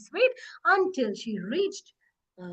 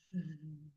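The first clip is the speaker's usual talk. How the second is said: fly buzz; 10 semitones lower, 13 dB quieter than usual. Acted. Fly buzzing.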